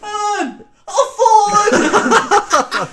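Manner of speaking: put-on very high, stretched drunk wail; drunken wail. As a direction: wail to the girlfriend, laughing. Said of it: "Ellen can just hear me in the background going".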